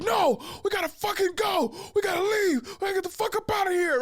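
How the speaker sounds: high, yelled imitation of an agitated friend; enraged insistence. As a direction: yell it up high, refusing to listen.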